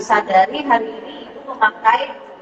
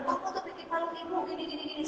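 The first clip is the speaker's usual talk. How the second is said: high, hushed imitation of a scolding; mock scolding; 7 semitones higher, 17 dB quieter, faster than usual. Mother telling off her child over a spilled drink, mock scolding. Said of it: "kita marahin anaknya duluan".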